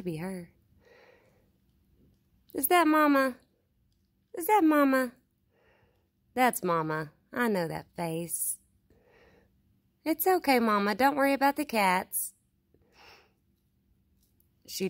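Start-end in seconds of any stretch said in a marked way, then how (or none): 2.5-5.1 higher, louder sing-song call to an animal; coaxing pet call. Real, not acted. Vlogger calling Mama and hushing the cats, coaxing loud call. 10.0-12.3 higher, louder sing-song call to an animal; coaxing pet call. Real, not acted. Vlogger calling Mama and hushing the cats, coaxing loud call.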